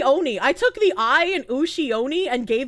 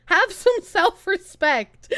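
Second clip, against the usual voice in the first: laughing; 9 semitones higher, 4 dB louder, slower than usual. Real, not acted. Laughing at findom fans.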